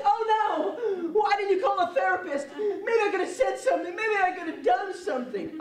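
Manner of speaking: high-pitched, hammed-up dismay; mock anguish. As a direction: wail it like a melodramatic therapist who came too late. Mock anguish over dead shake machine.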